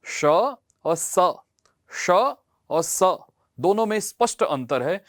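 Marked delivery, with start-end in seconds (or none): 0.1-1.1 very slow "श और स", श hissed as sh, स as plain s; teacher shows the clear difference; contrasting sh and s. Shown as a demonstration. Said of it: "मैं एक बार बोल के दिखाऊं". 1.9-3.2 very slow "श और स", श hissed as sh, स as plain s; teacher shows the clear difference; contrasting sh and s. Shown as a demonstration. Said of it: "मैं एक बार बोल के दिखाऊं".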